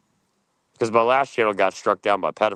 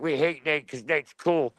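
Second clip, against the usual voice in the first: mocking rivals; 5 semitones higher, slower than usual.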